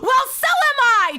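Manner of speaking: high, loud whoop; gleeful exclamation. Real, not acted. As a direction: belt it out in delighted agreement. Gleeful agreement with chat.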